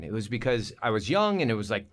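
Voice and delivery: usual speaking voice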